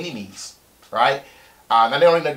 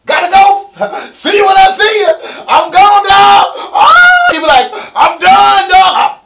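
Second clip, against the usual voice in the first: much higher and louder, yelling goodbye; gleeful quitting shout; 14 dB louder, slower than usual. Pretend. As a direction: shout it like you're storming out of work for good. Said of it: "you can walk into the office and be like".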